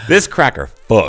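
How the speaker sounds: laughing through the slogan; giggling as you say it. Pretend.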